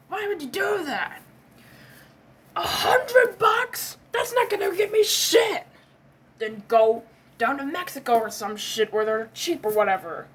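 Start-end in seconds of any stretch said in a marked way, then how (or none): 2.6-5.6 "hundred" pitched way up and drawn out slowly; outraged disbelief. Acted. Man mocking his friend's tiny budget, high outraged disbelief. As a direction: scoff at the sum in high disbelief.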